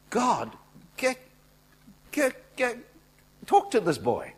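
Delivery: high, loud, sputtering protest; exasperated plea. Retold or imitated. Preacher urging God to rebuke Jacob, exasperated cry. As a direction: stammer it out at the top of the voice.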